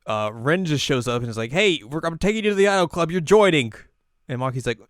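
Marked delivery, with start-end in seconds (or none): 1.5-3.7 high, loud insistent recruiter; bossy demand. Retold or imitated. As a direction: burst in and declare it loudly. Acted forceful recruiter.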